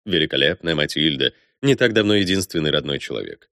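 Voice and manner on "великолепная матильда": ordinary delivery, nothing marked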